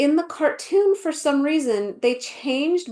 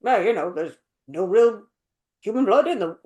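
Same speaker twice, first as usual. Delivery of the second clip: low, uninvolved mock line reading; unanimated; 3 semitones lower than usual. Imitating the British beaver telling the story, unanimated. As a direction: recite it with no animation. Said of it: "he's not just like".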